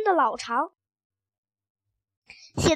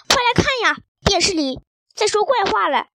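high, loud call from a startled dad; excited shout; 4 semitones higher, 5 dB louder than usual. A narrator's reading. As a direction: call the family over urgently. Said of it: "爸爸大叫了起来". (爸爸惊奇地大叫)

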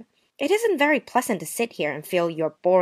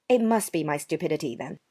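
ordinary talk, nothing marked

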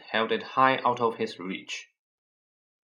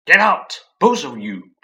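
loud, higher hoarse command; croaked order; 5 semitones higher, 11 dB louder than usual. A narrator's reading. Uncle Vernon orders the boys out, loud and croaking. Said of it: "croaked Uncle Vernon".

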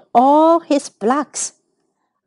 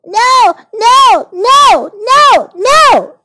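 high, loud repeated protest; little boy's high-pitched shout; shouting child; 11 semitones higher, 6 dB louder, much slower than usual. A narrator's reading. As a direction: yell each no like a boy in a tantrum. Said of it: "shouted Oliver".

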